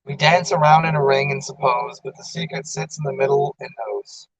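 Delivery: understated poetry reading; poem reading. Acted. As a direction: let the verse drift out softly. Recites short poems.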